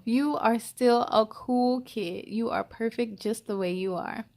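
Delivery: usual speaking voice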